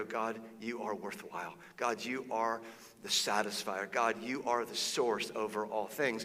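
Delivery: quietly pleading from exile; humble prayer. Retold or imitated. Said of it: "cry out to God and go".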